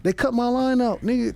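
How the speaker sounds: high, worked-up disbelief; comic outrage. Real, not acted. Comic telling the host his line got cut, exasperated. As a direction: protest it like a wronged man.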